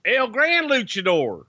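high, slow announcer call, R's not rolled; ring announcer call. Acted. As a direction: stretch the name out like a ring announcer. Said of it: "Say it like you did WrestleMania".

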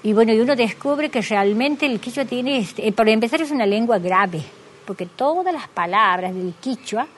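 ordinary talk, nothing marked

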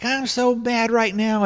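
very high-pitched mock fuming; worked-up anger. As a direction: imitate someone bursting in furious. Claire bursts in angry in retelling.

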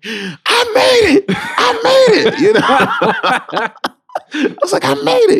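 very high, loud, laughing joy; screaming with joy. Retold or imitated. Running into the roommate's room, screaming with joy. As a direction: shriek the news to the roommate. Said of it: "I was screaming".

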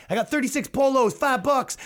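high mock street-vendor pitch; street seller. Comic imitation of a guy selling jeans at a riot. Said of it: "He goes".

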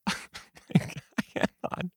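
stunned whisper. Real, not acted. Teacher overwhelmed.

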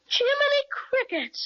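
high, slow amazed cry. Acted. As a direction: gasp it out in wonder. Amazed through the telescope.

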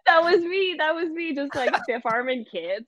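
high laughing voice. Real, not acted. Coach admits stiff-arming kids, laughing high. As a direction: laugh through the confession. Laughing about own rough play.

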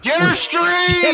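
high, slow, loud catchphrase yell; shouted catchphrase. Pretend. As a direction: belt it out like a comedy tour catchphrase. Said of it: "That's your catchphrase".

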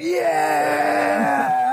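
long, high mock cheer; imitated fan cheer. Imitating fans' reaction to the team sheet, high drawn-out cheer. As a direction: cheer like a whole stand of fans. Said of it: "the fans are going".

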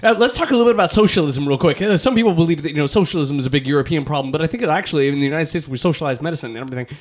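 very fast, run-on podcast chatter; sped-up talk. Acted. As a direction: gabble it like double-speed playback. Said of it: "now back to normal speed".

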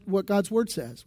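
usual speaking voice

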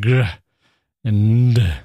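"g" and "d" pushed out as heavy bare sounds; isolated consonant sounds. Shown as a demonstration. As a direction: punch out "g", pause, then a weighted "d". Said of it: "A little bit of vowel in there between".

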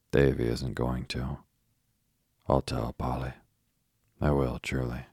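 ordinary delivery, nothing marked

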